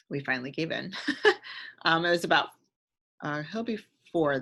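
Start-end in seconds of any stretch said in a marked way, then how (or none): 1.0-1.8 a quick breathy laugh, high; short laugh. Real, not acted. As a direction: let out a little sheepish laugh. Laughs after giving in.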